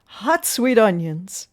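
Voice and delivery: loud, drawn-out hawker's cry; street vendor's call. A narrator's reading. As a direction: call your wares down the street. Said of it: "Sam called out on the street".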